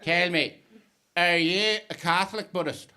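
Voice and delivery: imitation Northern Irish cabbie query; Northern Irish accent. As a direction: probe him in a Belfast accent. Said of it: "the inflection of his accent".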